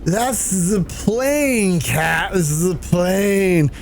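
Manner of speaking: put-on very slow, drawn-out drunken correction; imitated tipsy insistence. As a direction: drag the words out like a drunk. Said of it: "you get so drunk".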